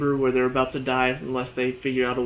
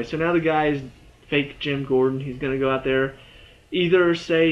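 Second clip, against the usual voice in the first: ordinary talk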